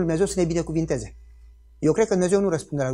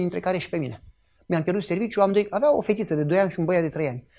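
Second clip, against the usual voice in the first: ordinary talk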